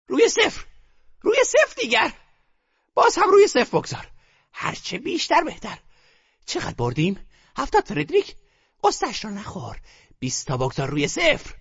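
put-on eager old grandmother. A narrator's reading. Grandmother urging her grandson to bet, eager high voice. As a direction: push him to bet on zero.

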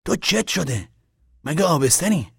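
loud, high, exasperated questions; heated questioning; irritated demand. A narrator's reading. Man demands answer in quarrel.

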